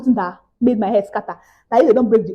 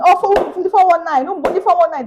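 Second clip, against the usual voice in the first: mock shout; 7 semitones higher than usual. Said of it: "if I start shouting".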